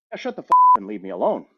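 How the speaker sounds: loud, rapid mock dismissal; quoted brusque boss. Boss to anxious employee before the trip, brusque dismissal. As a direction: imitate a boss cutting someone off, fast and forceful. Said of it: "Belfast, uh, Irish accent. He's like".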